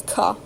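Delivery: a breathless, whispered throaty ka from the back of the throat; throaty K sound. Shown as a demonstration. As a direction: scrape ka at the back of the throat like in loch. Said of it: "plus the throaty K".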